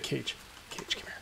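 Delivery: whispered call. Retold or imitated. Viewers whispering to Cage to come over, whispered. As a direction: hiss the name under your breath. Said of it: "And you're like".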